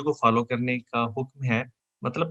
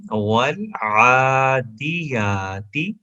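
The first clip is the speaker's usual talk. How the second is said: slow melodic tajweed chant; Quranic recitation; much slower than usual. Shown as a demonstration. Teacher chanting the verse for students, slow and melodic.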